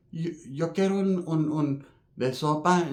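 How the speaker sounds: soft, slow, shy asking in broken Spanish; timid newcomer. Retold or imitated. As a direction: ask meekly for soup. Mino Bang asking for soup.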